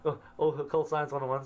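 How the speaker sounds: quick, lightly voiced "Oh, oh, occult science one oh one"; half-hearted imitation of a confused fan; mock listener catching on. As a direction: rattle it off like a listener who just got it. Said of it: "It's like".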